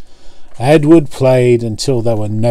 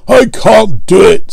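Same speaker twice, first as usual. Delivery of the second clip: higher, louder complaining engine; sulky engine; 8 semitones higher, 5 dB louder than usual. A narrator's reading. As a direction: whine it out, stubborn. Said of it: "said Gordon".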